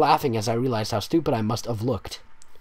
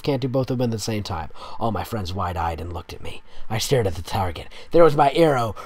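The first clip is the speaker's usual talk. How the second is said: ordinary delivery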